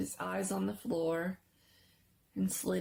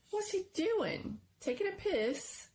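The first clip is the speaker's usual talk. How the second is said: mocking kid; 12 semitones higher than usual. A narrator's reading. Kenny mocking Charles.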